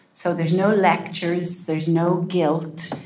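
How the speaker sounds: normal talking voice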